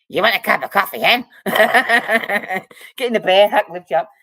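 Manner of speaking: hearty Scottish shout breaking into a laugh; loud Scottish father-in-law. Retold or imitated. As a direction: bellow it like a big loud Scotsman offering coffee. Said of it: "He yelled at you".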